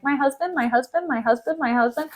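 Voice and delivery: soft, repetitive mutter; hushed echo of a stunned wife; repeating in disbelief. Retold or imitated. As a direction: say it under your breath, over and over. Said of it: "Amelia keeps just saying".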